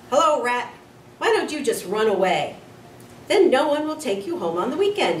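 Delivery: bully goading the hamster; bully taunting. A narrator's reading. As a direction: taunt the pet mockingly.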